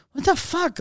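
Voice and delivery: retold high, stunned outburst; incredulous outrage. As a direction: squeak it out in mock shock. Retold shock at tyre bill.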